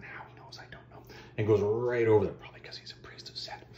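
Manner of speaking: hushed joke to the listener; breathy side comment; whispered aside. Real, not acted. Whispered asides about the priest.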